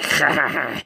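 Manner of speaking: imitated wheezy, hissing chuckle. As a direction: mimic a short hissy laugh. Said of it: "he does a kinda like".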